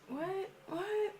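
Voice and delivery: small high, soft "what?"; hushed high-pitched confusion; baffled reaction. Retold or imitated. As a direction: squeak it quietly in disbelief. Startled reaction to sirens.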